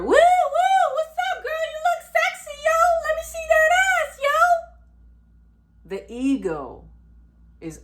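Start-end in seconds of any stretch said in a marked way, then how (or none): 0.0-4.4 very high, whooping mock catcall; squealed street catcall, pitched way up; catcall holler. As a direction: holler after a passing girl, hammed up. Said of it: "The ego needs to holler".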